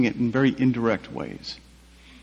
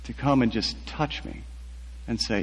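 ordinary talk, nothing marked

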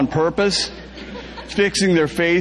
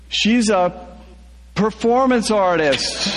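higher, slower yell; 5 semitones higher than usual. A narrator's reading. Narrator yelling from the bus window. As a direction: call out the window. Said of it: "I'm yelling out the bus window".

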